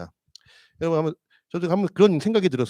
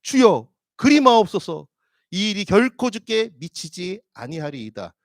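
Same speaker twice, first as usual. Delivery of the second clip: higher, louder, slow plea; pleading protest; 4 semitones higher, 6 dB louder, slower than usual. Acted. Peter protesting to Jesus, a high, loud slow plea. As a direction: cry out to stop him. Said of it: "항변하여 이르되".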